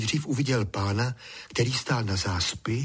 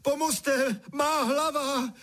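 very high, strained cry; screaming for help; 14 semitones higher, slower than usual. A narrator's reading. Drenched boy calls parents for help, screaming. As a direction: cry out at the top of your range. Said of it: "křičel, co mu hrdlo stačilo".

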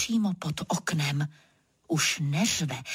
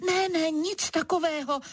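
mild mock shout; 8 semitones higher than usual. A narrator's reading. Narrator hoping to yell like a lion, mild shout. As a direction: cry out a refusal on a high pitch. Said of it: "Rozkřiknu se".

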